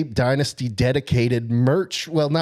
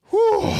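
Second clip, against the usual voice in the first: whew. Real, not acted. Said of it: "take a breath".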